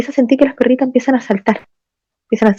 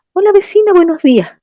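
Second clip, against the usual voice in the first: higher, bright hello to a neighbour; cheerful greeting; 10 semitones higher than usual. Retold or imitated. Father greets the neighbour lady, high and cheerful. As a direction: greet her casually as if nothing happened.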